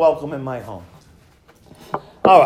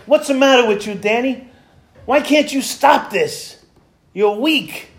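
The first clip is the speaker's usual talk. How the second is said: self-scolding in the mirror; 6 semitones higher than usual. Retold or imitated.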